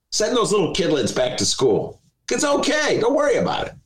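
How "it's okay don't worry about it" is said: high, breezy mock reassurance; sing-song it's fine. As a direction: brush off the worry in a lilting high voice.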